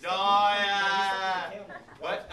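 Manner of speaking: drawn out and loud, hyping up; teacher whooping about Man versus Wild, loud; loud excited oh yeah. Real, not acted.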